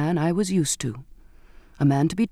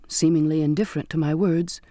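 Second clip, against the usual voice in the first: ordinary delivery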